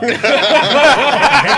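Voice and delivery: high, loud burst of laughter; bursting out laughing. Real, not acted. Laughing at Big D name.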